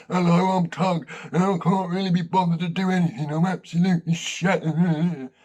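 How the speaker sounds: put-on bored old cockney dog; knackered cockney. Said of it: "he's a bit knackered and cockney".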